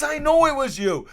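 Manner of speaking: hammed-up outraged lecture; imitating scolding Gretz. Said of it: "He says".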